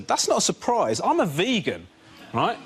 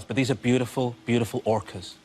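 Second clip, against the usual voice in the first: usual speaking voice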